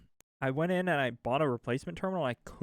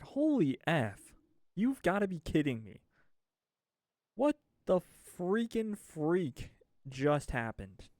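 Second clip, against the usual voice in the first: exasperated outburst; 4 semitones higher than usual. Retold or imitated. Retold frustration at car battery.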